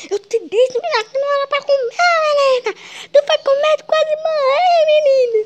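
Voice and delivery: very high scolding mother; scolding mom. Acted. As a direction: shout at her like an angry mom.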